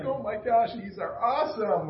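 high, thrilled rush of joy; bright excited exclamation; delighted gasp. Retold or imitated. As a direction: gush over the cards like a kid. Delighted reaction to old cards.

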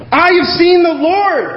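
high, jubilant proclamation; breaking the news with elation; excited announcement. Acted. Mary bringing the news, acted.

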